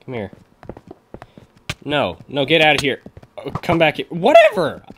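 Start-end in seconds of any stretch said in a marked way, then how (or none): 4.1-4.8 loud exasperated yelp, voice cracking high. Real, not acted. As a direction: let your voice crack upward on a dismissive shout. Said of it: "that was a horrible voice crack".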